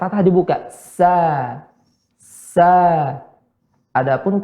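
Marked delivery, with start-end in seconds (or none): 0.7-2.7 SA with thinned lips, opening on the A; long thin S into open "sa". Shown as a demonstration. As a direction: draw out "Sa", light and open. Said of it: "sa ditipiskan bibir".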